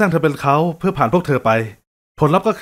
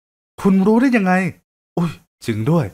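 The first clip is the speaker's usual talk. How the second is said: higher, slow startled question; surprised woman; 4 semitones higher, slower than usual. Acted. Bella surprised the blind man sees the sky, higher. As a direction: catch his slip with surprise.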